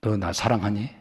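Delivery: a hushed, sincere question; soft, quiet parent's question. Pretend. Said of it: "자식이 말을 안 들어. 그러면은".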